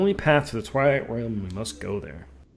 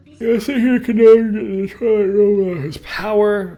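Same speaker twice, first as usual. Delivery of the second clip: dramatic villain voice; 9 semitones higher, 10 dB louder than usual. Acted. Reading villain's game dialogue.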